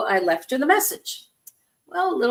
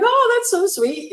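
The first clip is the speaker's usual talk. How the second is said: high-pitched, touched coo; imitating being sweetly overcome; retold tearful gushing; 12 semitones higher, 4 dB louder than usual. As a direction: gush as if moved to tears. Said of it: "I'm crying. I'm like".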